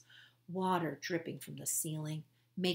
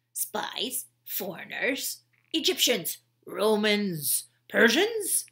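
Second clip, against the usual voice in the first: accusing guard; 3 semitones higher, 9 dB louder, slower than usual. Acted. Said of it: "said the squinty eye one".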